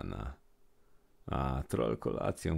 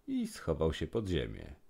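ordinary delivery, nothing marked

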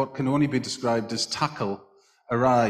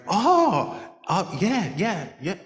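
mock delight; 8 semitones higher, 4 dB louder, slower than usual. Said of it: "and Dave went".

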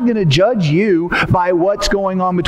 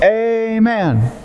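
mock crowd amen; 4 semitones higher than usual. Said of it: "everybody in the room went".

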